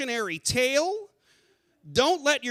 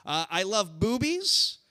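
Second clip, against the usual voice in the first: normal talking voice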